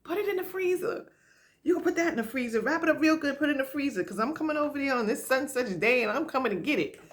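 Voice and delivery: high, insistent mock friend voice; bossy friend. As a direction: order her to freeze it, playfully. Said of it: "My friends are like".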